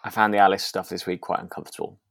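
usual speaking voice

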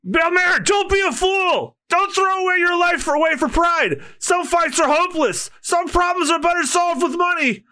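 high desperate shout; pleading shout. Acted. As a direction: beg her at the top of your voice. Genzo begs her to pay.

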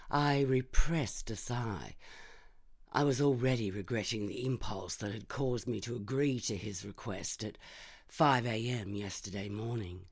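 ordinary delivery, nothing marked

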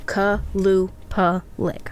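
slow, separated syllables in "Kalupalik". Shown as a demonstration. Teaching herself the name, slowly syllable by syllable. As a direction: sound out "Kalupalik" as ka, lu, pa, lik.

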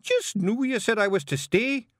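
high, baffled Feegle objection; confused Scots protest. Acted. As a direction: object in disbelief. Rob protests.

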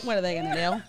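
ordinary delivery

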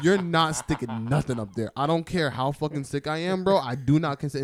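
loud mock-indignant refusal. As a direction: refuse outright, half joking. Protesting the procedure.

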